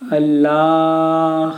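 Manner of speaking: अल्लाह with the ला stretched very long and slow; अल्लाह sung out long, as in a call to prayer; drawn-out Allah. Shown as a demonstration. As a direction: hold the middle vowel of अल्लाह like a call. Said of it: "जैसे अल्लाह बोलते हैं, बोलते हैं ना".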